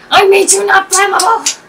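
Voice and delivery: high, shouted pretend despair; loud, high play-acted protest; mock outraged wail. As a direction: yell in hammed-up betrayal at the burning armor. Acted yelling at burning armor.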